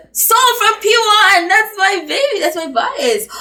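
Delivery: very high, loud fangirl shriek; excited squeal. Real, not acted. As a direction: shriek with delight at the favourite. Finds her favourite idol on the list.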